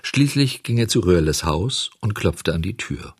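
plain reading voice

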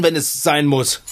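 ordinary talk, nothing marked

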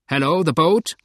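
high, loud hail across water; loud call. A narrator's reading. Man ashore hailing a passing boat, loud call. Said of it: "rief eine laute Stimme".

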